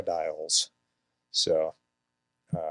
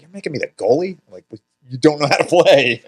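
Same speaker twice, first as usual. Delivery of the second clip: mock indignant protest; 8 semitones higher, 9 dB louder than usual. Indignant kid quoting himself.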